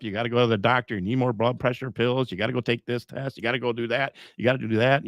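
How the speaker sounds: normal talking voice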